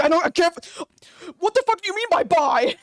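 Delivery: high, loud, exasperated squawk; mock outrage. Streamer mocking a song title, high exasperated outrage. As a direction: act baffled and furious at the word.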